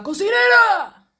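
high, loud, drawn-out shout; hammed-up imitation of a TV scream; imitated soap-opera yell. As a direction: holler it like a telenovela outburst. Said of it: "le gritaba".

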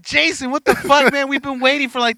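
high, loud exasperated complaint. Retold or imitated. Complaining to a friend who kept them waiting, high and exasperated. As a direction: voice climbs high in disbelief.

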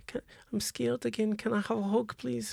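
put-on high, soft little child voice pleading; a small frightened kid asking for a hug; scared child. GM as Thrapple begging Grace for a hug, scared child voice.

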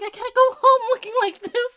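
high, loud imitation of a friend; hammed-up complaint on the edge of laughter; mock distressed plea.

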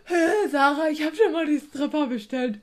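mocking imitation. Kollegin wird spöttisch nachgeäfft.

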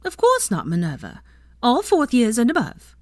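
high, indignant retort; affronted. A narrator's reading. Nurse affronted by Minerva's question, indignant and high. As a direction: bristle at the very suggestion. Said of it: "Poppy looked affronted".

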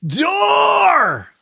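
very high, drawn-out shout; yelled warning. Retold or imitated. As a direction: yell it out like a jump master's command. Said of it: "and he yells".

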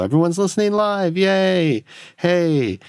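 high-pitched pretend crowd-pleasing cheer; mock radio cheer. Host pretending his radio show is live, mock cheer. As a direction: hype up a fake live audience.

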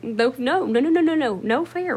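high, loud string of objections; mock protest. Sister telling brother he can't cheat, protesting. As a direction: cut him off in playful protest.

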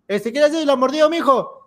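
put-on a mother fretting over her crying kid; worried mother. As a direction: mom telling the neighbor to mind her dog, high and fretting. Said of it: "y mi mamá le decía".

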